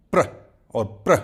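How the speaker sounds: "pr" as a short burst of pushed air; demonstrating pr sound. Shown as a demonstration. Teacher showing learners the P and R blend, demonstrating pr. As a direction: pop the lips open into "pr". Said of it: "We push the air out of our mouth".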